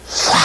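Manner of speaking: sharp imitated "ssak" pop; mock explosion hiss. Shown as a demonstration. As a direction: snap out the sizzle of sodium on water. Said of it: "이런 소리". (물 위에서 터지는 소리를 흉내 내며 싹!)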